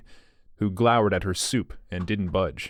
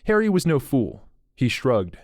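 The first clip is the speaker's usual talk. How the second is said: usual speaking voice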